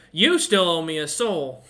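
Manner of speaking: loud, pointed demand from a demon; menacing tiefling. Acted. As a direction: press the debt like a fiend. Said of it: "he looks at Daka".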